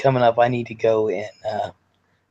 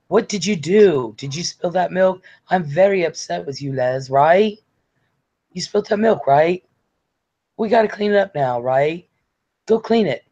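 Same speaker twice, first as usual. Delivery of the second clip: mock-cross British scolding, higher pitched; Sarah mad; 5 semitones higher than usual. Sarah telling Les off for spilt milk, mock mad. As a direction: imitate a mildly angry woman telling off a naughty child. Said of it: "This is Sarah mad".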